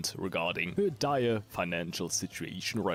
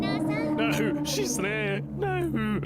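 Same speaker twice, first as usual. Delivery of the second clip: put-on squeaky high anime girl cry; shrill cartoon protest; high character voice; 6 dB louder, much slower than usual. Game character cries out.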